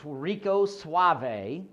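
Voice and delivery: drawn-out, very slow suave purr; smooth operator. Pretend. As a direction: lay on the mock charm. Boasting of smooth debating persona.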